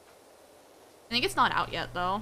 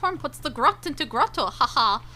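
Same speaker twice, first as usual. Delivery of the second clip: high-pitched mock German accent; attempted German accent; 8 semitones higher than usual. Streamer playing a little German boy for chat, mock German accent. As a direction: put on a shaky-knowledge German accent, pitched well up. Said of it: "I don't know how to do a German accent".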